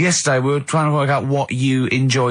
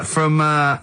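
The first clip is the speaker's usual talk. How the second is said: usual speaking voice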